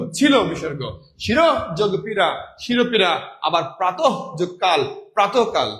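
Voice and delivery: usual speaking voice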